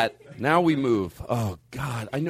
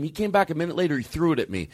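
ordinary delivery

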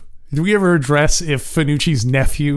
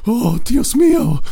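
high, slow gasp of dismay; a wife dropping the dish in shock; mock horrified wife; 7 semitones higher, slower than usual. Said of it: "she's just like".

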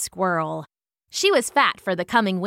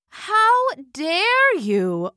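high, very slow indignant gasp of words; affronted; 10 semitones higher, 3 dB louder, much slower than usual. A narrator's reading. Queen wasp answering the bee's insult, affronted. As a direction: draw out every word in outrage. Queens quarrel over the honey.

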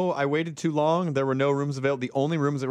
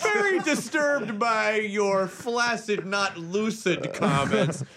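slow, deliberate network executive; Tad Nickelodeon; 4 semitones higher, slower than usual. Pretend. Mock executive reprimanding a children's entertainer, slow and deliberate. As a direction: play a disturbed boss, drawn out. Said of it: "I own Nickelodeon".

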